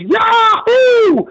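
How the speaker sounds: imitating a drunk fan's high, loud whoop; drunk yahoo. Retelling a drunk fan at the tailgate, high and loud yahoo. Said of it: "had too much to drink and said".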